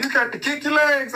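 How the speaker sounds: high, pushy father's order; imitating dad's orders; mock stern dad. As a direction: nag the kid to kick. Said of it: "And you like".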